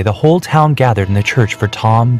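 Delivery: usual speaking voice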